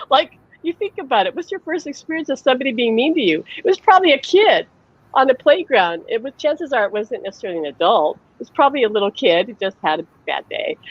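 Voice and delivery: ordinary talk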